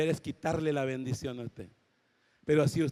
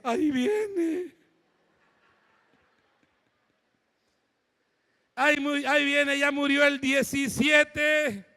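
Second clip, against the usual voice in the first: mocking laugh; 9 semitones higher, 3 dB louder, slower than usual. Patients mocking, next to die.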